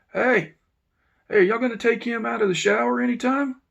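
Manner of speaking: loud, high yell from upstairs. Retold or imitated. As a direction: shout down the tier to the officers. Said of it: "the warden yells down".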